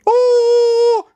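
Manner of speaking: put-on loud, very high girlish shriek; a frightened little girl's cry; high startled scream. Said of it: "and screamed".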